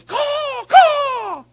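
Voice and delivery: very high, loud caw; piercing bird-call yell; crow call. Retold or imitated. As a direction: imitate a judge yelling a crow call. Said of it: "crow call".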